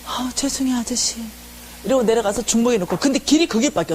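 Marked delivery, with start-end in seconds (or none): 0.1-1.2 timid apology. Retold or imitated. Frightened volunteer apologising to the tape seller, timid. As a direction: apologise meekly, as if intimidated. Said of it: "그러면 너무 무서운 거예요".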